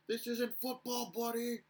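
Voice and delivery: high-pitched mock chirp from opponents; retold heckling yell. Opposing players chirping him after board hits, mock yell. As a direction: draw out a jeering yell, imitating a heckler. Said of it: "they would just yell".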